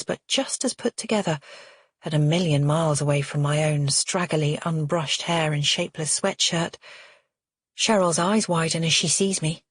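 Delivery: plain reading voice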